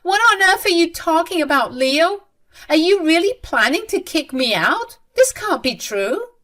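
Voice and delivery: high, loud incredulous questioning; acted shocked mother; complete disbelief. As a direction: question it as if it can't be real. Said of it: "still in complete disbelief and said".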